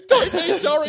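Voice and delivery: retold urgent yell; loud protest. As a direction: brush off his apology with a shout. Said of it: "I'm like".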